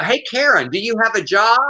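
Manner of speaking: high-pitched mock heckle; imitated protester needling an opponent; heckling protester. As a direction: call out tauntingly like a protester. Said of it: "one of the protesters kept saying".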